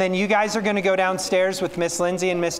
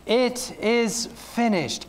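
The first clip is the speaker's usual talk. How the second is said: slow, high, drawn-out proclamation rather than a real yell; loud shout; 8 semitones higher, much slower than usual. Acted. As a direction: proclaim it slowly like a victory. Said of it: "Jesus shouted out in a loud voice".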